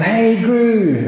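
high bright call of a cheery neighbour; jovial neighbour greeting. Retold or imitated. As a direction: sing out the greeting like a friendly neighbour. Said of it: "his jovial neighbour, uh, says to him".

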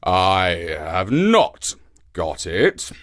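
slow, low lion growl; very slow, grumbling lion. A narrator's reading. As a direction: grumble sourly as the beaten lion. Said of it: "growled the lion".

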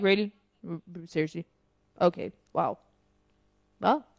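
low, quiet, unimpressed reaction; deadpan disbelief. Retold or imitated. Host retelling their reaction to a film, deadpan disbelief.

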